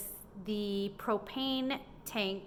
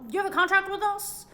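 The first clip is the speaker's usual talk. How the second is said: much higher mocking salesman voice; 11 semitones higher, 6 dB louder than usual. Imagining a salesman bothering her dad, mocked high. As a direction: sneer his question up high.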